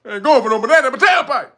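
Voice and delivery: loud, quick Eddie Murphy quote; Eddie Murphy impression. Streamer urges viewers to answer like Eddie, cocky. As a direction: tell them off like Eddie, cocky. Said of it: "tell them like Eddie said".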